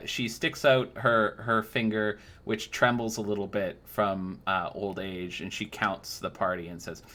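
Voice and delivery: ordinary delivery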